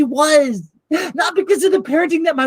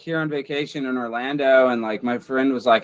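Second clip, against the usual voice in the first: woefully unconcerned; 5 dB quieter than usual. Acted. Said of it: "be woefully unconcerned".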